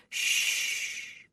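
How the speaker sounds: soft soothing hush; whispered shush to a crying infant. A narrator's reading. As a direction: hush the baby gently. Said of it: "she whispered".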